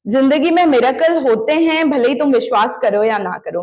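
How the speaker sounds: ordinary delivery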